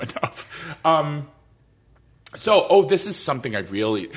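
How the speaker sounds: ordinary talk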